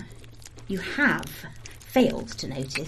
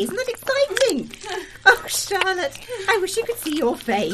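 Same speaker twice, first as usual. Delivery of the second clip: gleeful; 11 semitones higher than usual. Acted. Gloating over trapped girl.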